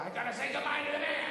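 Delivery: very high-pitched acted dad yell; yelling at kids. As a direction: yell it at the kids, far too worked up. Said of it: "I'm yelling at the kids too loud".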